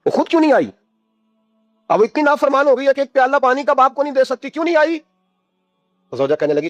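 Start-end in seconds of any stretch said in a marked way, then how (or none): 0.1-5.0 an upset father demanding why she didn't come; fatherly anger, raised pitch; angry father. A narrator's reading. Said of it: "حضرت عمر بن عبدالعزیز کو غصہ آیا".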